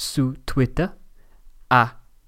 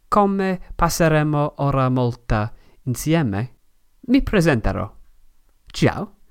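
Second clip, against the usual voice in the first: ordinary talk, nothing marked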